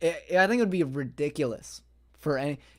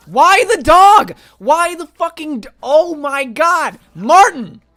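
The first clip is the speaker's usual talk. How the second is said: much higher and louder, drawn-out furious shriek; outraged scream; 12 semitones higher, 16 dB louder, slower than usual. Real, not acted. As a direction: scream in disbelief at the killer. Outraged as Martin kills dog.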